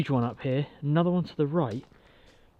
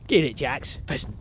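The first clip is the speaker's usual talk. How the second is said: usual speaking voice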